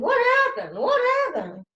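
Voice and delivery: high, loud eager questions; eager asking. Acted. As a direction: call out eagerly. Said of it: "kept asking".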